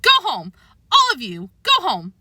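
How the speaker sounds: angry shooing yell. A narrator's reading. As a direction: shout them out of the room. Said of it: "George yelled".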